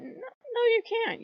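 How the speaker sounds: high, emphatic refusal; high, emphatic defiant no. Real, not acted. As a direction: shoot it down sharply. Defiant reply to mock acceptance.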